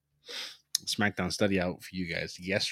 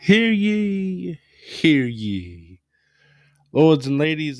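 booming town crier announcing; royal herald proclamation; 6 semitones higher, 9 dB louder, much slower than usual. Pretend. Mock herald opening the review.

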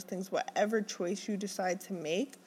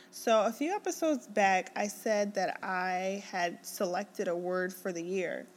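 ordinary delivery

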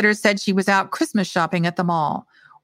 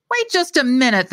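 indignant, louder and higher; rising voice; 6 semitones higher, 3 dB louder than usual. A narrator's reading. Caterer offended by poison claim, indignant rising voice. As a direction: bristle at the accusation. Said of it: "his voice rising".

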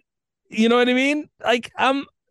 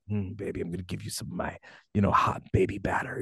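low, much softer breathy murmur, quick; sexy voice; 8 semitones lower, 9 dB quieter, faster than usual. Pretend. Lover murmuring dirty talk to his partner, soft and sultry. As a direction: purr it close and intimate, like bedroom talk. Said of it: "if it's said in a sexy voice".

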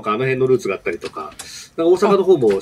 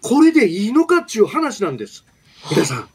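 impassioned, high-pitched Kansai-tinged political speech; Yamamoto Taro impression; 8 semitones higher than usual. Announcer obliges a listener's request for a politician, impassioned high-pitched impression. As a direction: plead like a fiery opposition politician, pausing between bursts. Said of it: "某山本さんのモノマネ".

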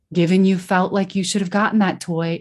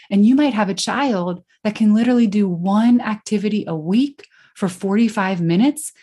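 normal talking voice

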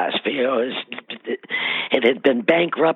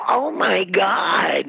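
slow, high, half-shouted disbelief; drawn-out stunned exclamation, pitched up high; slow, stunned and high, as if the crowd just hit her; amazed oh my God; 7 semitones higher, much slower than usual. Retold or imitated. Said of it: "it was just like".

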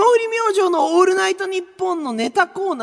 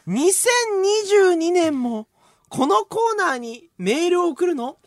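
slow reading; slower than usual. Acted. Reading listener's mail slowly.

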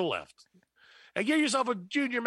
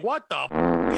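shocked; 9 semitones lower, 7 dB louder, slower than usual. Acted. Mock customer outrage in ad.

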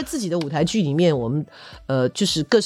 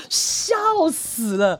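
crying with laughter, slow; laughing; 8 semitones higher, much slower than usual. Real, not acted. Recalling the rehearsal for the host, laughing helplessly. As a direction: choke out the words through laughter.

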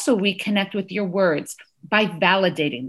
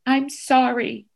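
put-on apologizing toddler; 3 semitones higher than usual. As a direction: say sorry like a small child, word by word. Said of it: "She went to him, she said".

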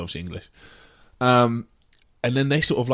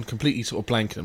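ordinary delivery

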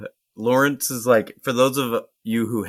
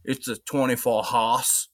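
put-on old timer Maine accent; 3 semitones higher than usual. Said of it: "old timer ... he's like".

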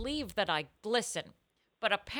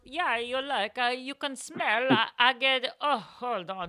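lightly voiced Italian coffee seller on the phone; a hint of a cartoon Italian accent; imitating Italian vendor; 4 semitones higher than usual. Retelling the vendor's excuses with a slight Italian accent. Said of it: "and say".

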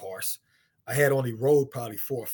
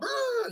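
imitated horse squeal. Horse rearing up.

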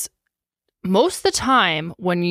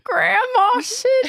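delighted squeal; 13 semitones higher, much slower than usual. Real, not acted. Delighted at the costume.

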